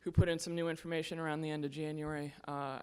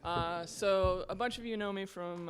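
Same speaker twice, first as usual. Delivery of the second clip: ordinary talk, nothing marked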